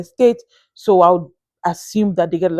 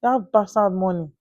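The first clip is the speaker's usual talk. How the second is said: hushed daring word; 7 dB quieter than usual. Real, not acted. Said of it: "I didn't know whether I can say the word".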